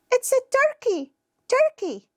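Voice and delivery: put-on very high-pitched child answering; squeaky small kid naming an animal; little child.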